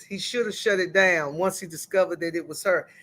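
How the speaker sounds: usual speaking voice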